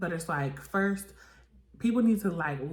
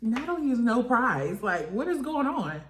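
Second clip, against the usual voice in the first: incredulous; 6 semitones higher, 4 dB louder than usual. Real, not acted. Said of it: "I'm like".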